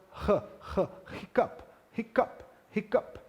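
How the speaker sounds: a long breathy "h, h" before "hiccup"; exaggerated aspirated h. Shown as a demonstration. Teacher exaggerating the H puff for students. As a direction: blow out the H hard, then "hiccup". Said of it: "Esse som de H aspirado".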